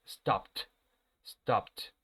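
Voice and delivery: "Stopped" as one syllable ending in a T. Shown as a demonstration. Teacher models "Stopped" for class, -ed pronounced as T. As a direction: say "Stopped" with silent E, final -ed a crisp T. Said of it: "se va a pronunciar como si fuera una T".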